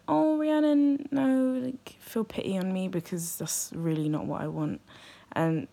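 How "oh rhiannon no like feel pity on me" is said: high, drawn-out mock sympathy, easing down; mock pitying. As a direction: imitate someone cooing with pity. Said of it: "I don't want you to be like".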